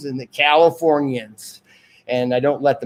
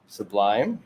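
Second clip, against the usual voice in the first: normal talking voice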